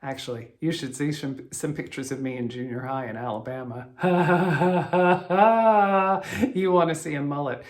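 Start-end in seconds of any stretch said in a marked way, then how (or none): 3.9-6.5 loud laugh. Real, not acted. Laughing at own mullet photos.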